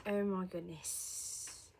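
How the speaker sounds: very slow, stretched exclamation; drawn out. Real, not acted. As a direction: drag every word out. Repeats it drawn out.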